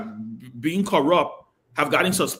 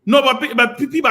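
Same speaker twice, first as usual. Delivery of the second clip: loud interruption; 6 semitones higher, 8 dB louder than usual. Real, not acted. Cutting into heated panel debate.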